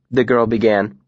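plain reading voice

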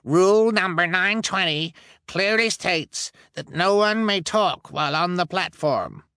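high, pompous rule reading; stern elder. Acted. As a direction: recite the rule with authority.

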